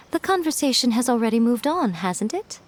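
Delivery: ordinary delivery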